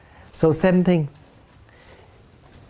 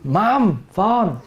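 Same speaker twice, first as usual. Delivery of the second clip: blunt call to mom; 3 dB louder than usual. Retold or imitated. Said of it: "This is how you always happen in the family".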